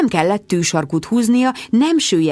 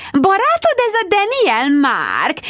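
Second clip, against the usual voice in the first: magas hangú, kotnyeles dáma; kérdezd magasan, tolakodó kíváncsisággal; 13 semitones higher, slower than usual. A narrator's reading. (magas, kotnyeles kérdés)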